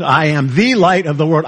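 "the" punched and said as "thee"; a stressed "thee" stands out. Shown as a demonstration. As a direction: hit "the" before "light". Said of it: "he could have emphasized anything".

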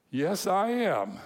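imitating an excited boy's proud yes; excited teenage boy. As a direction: stretch it out like a thrilled sixteen-year-old. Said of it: "like, all excited".

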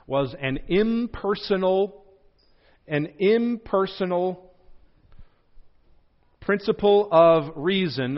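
impersonal said slowly for note-takers; slow, deliberate dictation pace. Shown as a demonstration. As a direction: spell it out slowly for notes. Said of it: "I'm gonna say that again".

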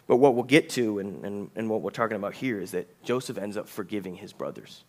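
ordinary delivery

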